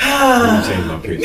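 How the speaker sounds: high laughing joke. Real, not acted. Joking after sexy voice remark.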